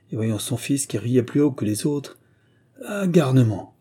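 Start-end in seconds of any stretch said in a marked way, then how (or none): 2.9-3.8 a higher, louder angry shout at his son; father shouts angrily at his laughing son; angry cry. A narrator's reading. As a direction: scold him in a fit of temper. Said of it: "lui cria-t-il".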